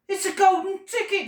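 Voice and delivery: high, loud storybook scream; excited scream. A narrator's reading. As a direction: scream the discovery as the shopkeeper. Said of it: "screamed the shopkeeper".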